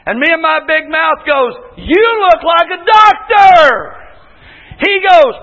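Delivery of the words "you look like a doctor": loud blurt. Retold or imitated. Teller blurts remark to doctor.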